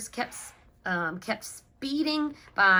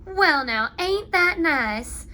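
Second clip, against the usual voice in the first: louder, slow drawn-out folksy voice; street sweeper admiring; 8 semitones higher, 9 dB louder, slower than usual. A narrator's reading. Street sweeper admiring the ducks, slow and loud. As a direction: draw it out slowly, pleased. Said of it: "the man who sweeps the street said".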